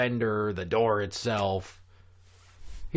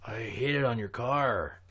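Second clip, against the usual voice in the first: hurt kid in a put-on Mexican accent; exaggerated Mexican-accented complaint; 5 dB quieter than usual. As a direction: whine it in a mock Mexican accent. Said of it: "The horrible accent".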